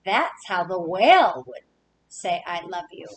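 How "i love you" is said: soft, lower i love you; tender i love you. Acted. Reading to kids, the whale saying it tenderly. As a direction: breathe it softly to a loved one.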